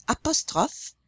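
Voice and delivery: "apostrophe" in French, pitched high; French pronunciation model. Shown as a demonstration. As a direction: say "apostrophe" the French way for a class to echo. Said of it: "repeat after me".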